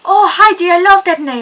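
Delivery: loud, high, gushing mock Greek accent; bad Greek accent. Stranger on the bus praising her name, in a bad Greek accent. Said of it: "a really bad Greek accent".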